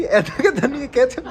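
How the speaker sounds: retold line spoken through laughter; can barely get the words out for laughing; friend's cheeky retort retold while laughing; laughing quote. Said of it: "ये कहती".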